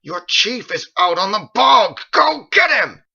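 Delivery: slow, very loud, high enraged yell; furious shout. A narrator's reading. Halkrith screams at the confused men to fetch the chief. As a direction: scream at the idle men to move. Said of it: "shouted into a fury".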